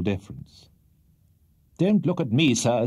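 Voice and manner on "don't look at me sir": a sharp rebuke from the bench, a touch louder. A narrator's reading. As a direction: snap at the witness like a testy judge. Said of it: "said the judge sharply".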